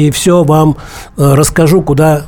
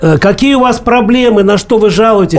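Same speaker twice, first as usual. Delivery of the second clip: to someone hard of hearing; 5 semitones higher than usual. Real, not acted. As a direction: speak up for a deaf caller. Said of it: "Я плохо слышу".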